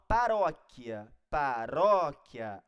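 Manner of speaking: paróquia slow, with a loud, stretched RÓ; stress on RÓ. Shown as a demonstration. Teacher showing where the strong syllable is, RÓ stretched in paróquia. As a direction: lean hard on the RÓ of paróquia. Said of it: "a sílaba forte tá aqui".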